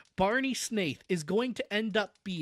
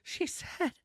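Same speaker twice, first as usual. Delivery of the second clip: high, breath-only whisper; 13 semitones higher, 4 dB quieter than usual. A narrator's reading. Cousin confessing what Valancy said, whisper. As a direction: barely whisper it, scandalised. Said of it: "voice was little more than a whisper".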